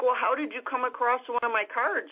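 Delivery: high-pitched, incredulous question; retold astonishment, voice lifted high; amazed questioning. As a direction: ask it in happy disbelief. Amazed question about the card.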